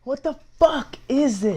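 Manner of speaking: high, slow, incredulous cry; looking at a plastic Snapple bottle, high slow disbelief; real disbelief. Real, not acted. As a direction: sound truly baffled by the bottle.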